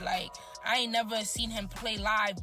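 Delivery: usual speaking voice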